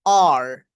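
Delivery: "আর" low and loud with a curled R; drawn-out "আর", R fully sounded; American R. Shown as a demonstration. Teacher shows how R should come out, American R. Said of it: "এটার উচ্চারণটা এমন আসবে".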